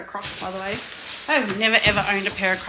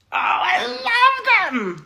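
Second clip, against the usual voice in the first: high, slow, near-tearful cry of delight; overjoyed squeal; 11 semitones higher, much slower than usual. Real, not acted. Unboxing her first Crocs, high slow squealing near-tearful delight. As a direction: gush over them almost crying with joy.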